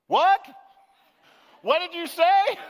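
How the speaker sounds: high, loud "what", hard of hearing; loud puzzled old man. A narrator's reading. Deaf old man in the airport asking again, loud and high.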